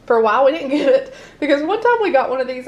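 ordinary delivery

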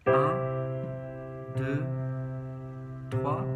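ordinary talk, nothing marked

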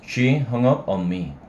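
usual speaking voice

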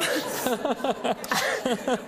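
a bright breathy burst of laughter. Real, not acted. Woman laughing at her urge to call the Queen. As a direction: laugh out loud at her own idea.

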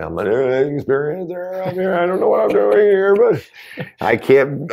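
pleading urgently to be believed, slowing at the end; emotional plea. Retold or imitated. Retold emotional plea to firemen.

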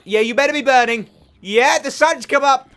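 loud, high gloating shout. Real, not acted. Gamer gloats at burning zombies, shouting. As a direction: shout it up high, stretching the last words. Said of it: "I'm shouting".

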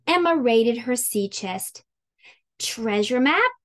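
ordinary delivery

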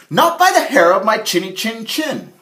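high, loud piglet refusal; defiant little pig. Acted. Harold refuses the wolf from inside, high and loud. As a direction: squeal it back defiantly. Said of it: "Larry replied".

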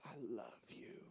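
soft whisper, slow and tender. Retold or imitated. Little girl whispering love to her dad. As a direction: breathe it like a little girl's secret. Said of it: "She goes".

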